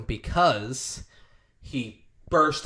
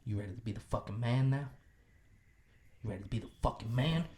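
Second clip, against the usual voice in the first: intense murmur; 2 semitones lower, 10 dB quieter, much faster than usual. Retold or imitated. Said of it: "Matthew McConaughey goes".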